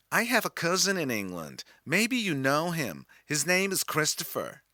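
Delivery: a lightly done, friendly American stranger; chatting to a boy from Liverpool in a light American accent; friendly American woman. Retold or imitated. American woman talking to him.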